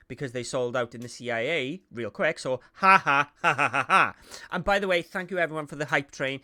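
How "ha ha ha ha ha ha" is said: fake laugh. Mock laugh about sold out.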